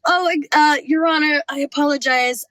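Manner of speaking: addressing the judge, half-nervous; polite juror. Retold or imitated. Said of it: "I was like".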